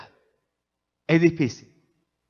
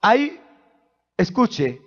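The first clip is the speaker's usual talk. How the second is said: high, loud, calling-out sermon voice; preaching at full force; 7 semitones higher, 3 dB louder than usual. Acted. Pastor questioning the church about the altar, preaching at full force. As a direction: ask and answer with fire.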